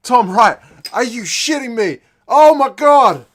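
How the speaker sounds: very loud, high outburst of disbelief; shocked yell. Real, not acted. Fan seeing a try straight from the restart, shouting in disbelief. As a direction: yell at the telly as it happens.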